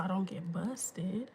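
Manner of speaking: whispered, a bit slow; whispered aside. Real, not acted. Secret hiding from the kids.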